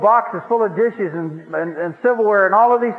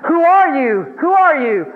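loud, higher calls of who are you; calling out; 8 semitones higher, 7 dB louder, slower than usual. Retold or imitated. Romanians chasing him to the car, calling out loud. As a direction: call after him as you run.

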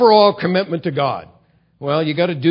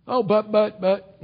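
halting, higher stammered objection; mock protesting objector; 5 semitones higher than usual. Preacher mimics listener resisting the advice, mock protest. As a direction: imitate someone sputtering excuses.

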